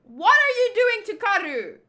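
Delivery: high, loud scolding shout; outraged yell. Acted. Scolding the crew over their treatment of Karu, outraged yell. Acted scolding before hitting them.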